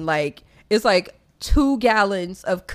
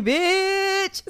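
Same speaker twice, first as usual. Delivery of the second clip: excited high bitch; 9 semitones higher than usual. Real, not acted. Hyped about her weekend party, giddy high. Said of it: "hyped about party".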